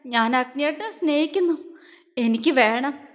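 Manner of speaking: loud, unhinged raving; raving like a madwoman. A narrator's reading. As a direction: babble wildly, obsessed. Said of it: "അവളൊരു ഭ്രാന്തിയെപ്പോലെ പുലമ്പിക്കൊണ്ട്". (ഭ്രാന്തിയെപ്പോലെ പുലമ്പൽ)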